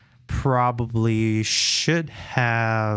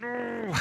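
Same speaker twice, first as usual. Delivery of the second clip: pained high whimper; 9 dB quieter than usual. Real, not acted. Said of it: "It hurts".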